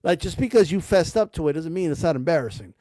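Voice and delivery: ordinary talk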